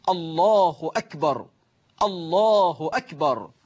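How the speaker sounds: الله أكبر slow, short "hu" and short "akbar"; correct takbir. Shown as a demonstration. Teacher models how we say it, الله أكبر with short "hu akbar". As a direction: say الله with a short final u, then a crisp hamza on أكبر. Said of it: "أما كيف نقول".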